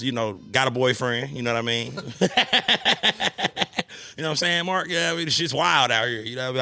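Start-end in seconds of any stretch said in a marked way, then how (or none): 2.0-4.1 high laugh. Real, not acted. Laughing about girlfriend's boyfriend.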